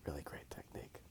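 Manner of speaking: normal talking voice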